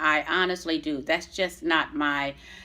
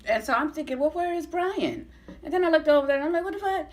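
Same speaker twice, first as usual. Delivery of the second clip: incredulous disbelief; 9 semitones higher, faster than usual. Retold or imitated. Shocked, Brian ignores her.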